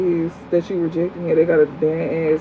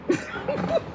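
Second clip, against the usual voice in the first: squeaky mock; 7 dB quieter than usual. Real, not acted. Mocking a commenter.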